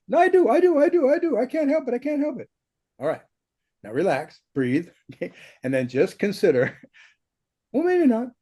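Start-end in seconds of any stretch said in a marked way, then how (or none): 0.1-2.5 high, fast-running whiny protest of the mind; octave-up protesting brain. Acted. Teacher voicing the brain's objections to a student, high-pitched protest. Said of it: "Your voice goes up an octave". 7.7-8.3 high-pitched little voice of doubt; octave-up doubting brain. Acted. Teacher voicing a mind that won't consider it, high-pitched doubt. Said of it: "why you talk like that".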